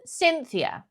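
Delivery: high mock-posh address to the hostess; imitating a pseudo-posh dinner guest; pseudo-posh accent. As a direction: call the name in affected posh English. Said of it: "She says".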